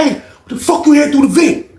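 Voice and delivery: high loud call; calling out to the neighbor. Retold or imitated. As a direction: ask through the wall. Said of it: "he was like".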